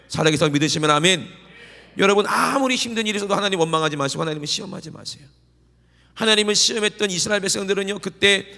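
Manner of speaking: loud, rising revival preaching; fervent preaching. Acted. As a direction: drive the congregation to response. Preaching at full force. (힘차게 외치는 부흥 설교)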